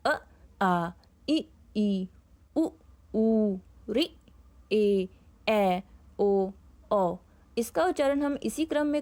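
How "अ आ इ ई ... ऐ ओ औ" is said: each vowel said slowly and separately, a pause after each; reciting vowels for pupils; vowel recital. Shown as a demonstration. Teacher reciting the vowels one by one to the class. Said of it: "स्वर का उच्चारण करेंगे".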